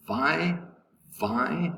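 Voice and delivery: "vi" alone, voice rising; isolated first syllable. Shown as a demonstration. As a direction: sound out "vi" by itself, with pauses. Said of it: "the first syllable".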